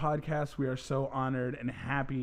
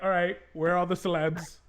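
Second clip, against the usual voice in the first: mock celebrity hunter; 3 semitones higher, 4 dB louder than usual. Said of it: "you're like".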